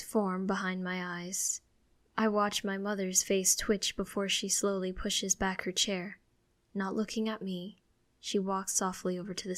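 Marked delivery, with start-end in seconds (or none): none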